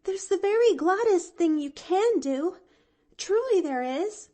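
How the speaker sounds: put-on high, loud, insistent young girl; excited child insisting; eager little girl. A narrator's reading. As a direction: urge him warmly. Pollyanna eager.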